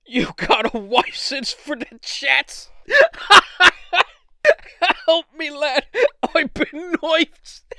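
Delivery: high-pitched, slow British parody breaking into laughter; bad British accent. Knifed caller pleads for help, hammed-up British accent. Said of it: "my British impression is not good".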